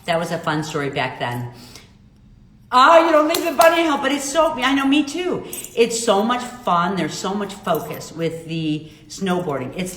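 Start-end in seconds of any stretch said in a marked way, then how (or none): 2.7-5.4 excited. Real, not acted. Reads viewer comment, excited squeal.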